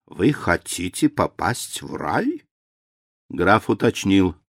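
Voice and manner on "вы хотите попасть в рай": мягко. A narrator's reading. Священник мягко уговаривает графа.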